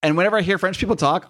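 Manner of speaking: normal talking voice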